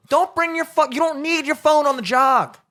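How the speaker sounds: high-pitched, heated insistence; exasperated rant. Real, not acted. Ranting at people who bring phones jogging, exasperated. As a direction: plead it loudly, worked up.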